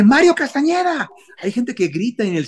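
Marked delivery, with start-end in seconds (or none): 0.0-1.1 high excited shout of a fan's name. Retold or imitated. Fan in the cinema shouting the dubber's name, excited yell. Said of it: "y grité".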